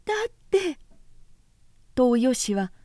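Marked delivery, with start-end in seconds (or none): 0.1-0.8 high, crying protest; tearful. A narrator's reading. Oyoshi protests to her scolding sister, crying. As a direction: whimper it on the verge of tears. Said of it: "泣き声で言った".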